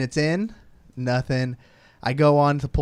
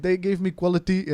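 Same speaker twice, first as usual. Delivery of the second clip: bad South African accent; lightly put-on South African voice; 3 semitones higher than usual. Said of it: "That is the worst South African accent".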